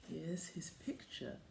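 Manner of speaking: very soft whispered reveal. Acted. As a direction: whisper as you show the picture. Revealing old picture to kids.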